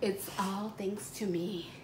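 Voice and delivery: smug whispered aside; whispering. Acted. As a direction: breathe it as a secret. Smug aside after the praise.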